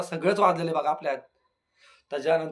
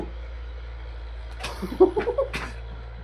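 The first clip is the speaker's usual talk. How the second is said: very high airy mock laugh, rising; exaggerated imitated laugh. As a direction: ham up his odd laugh to tease him. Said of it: "इतकं असं दर्जेदार हसतो ना".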